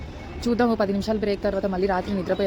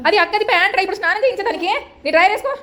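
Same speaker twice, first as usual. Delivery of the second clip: high, loud telling-off; exasperated scolding; 9 semitones higher, 5 dB louder, faster than usual. Real, not acted. Mother to child wearing sister's pants, exasperated scolding.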